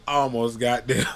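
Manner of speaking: ordinary talk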